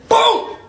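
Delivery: high, loud "Boom!" as the fist lands; punch sound effect. Acted. Acting out Uncle Curtis's overhand punch, loud boom. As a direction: slam the word out like a punch.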